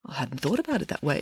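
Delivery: very soft, abashed confession; chastened and hushed; sheepish admission. A narrator's reading. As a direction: admit it in a small quiet voice. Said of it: "admitted the king".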